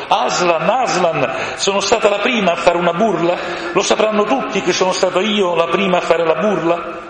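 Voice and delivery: a proud little bird begging to know; excited crow. A narrator's reading. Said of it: "la cornacchia". (la cornacchia eccitata, voce acuta e forte)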